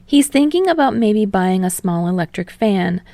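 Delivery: ordinary delivery, nothing marked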